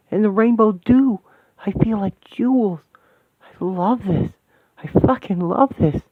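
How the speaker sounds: overflowing with blissful awe; giddy, high, loud rapture at a shining vision; ecstatic wonder. Acted. Ecstatic vision in a new realm.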